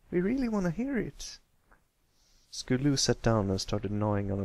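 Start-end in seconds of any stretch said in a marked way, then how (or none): 0.1-1.4 higher filly voice, gently eager; lightly voiced filly coaxing; Applebloom's voice. A narrator's reading. Applebloom coaxing Pipsqueak to share his story. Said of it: "Applebloom smiled".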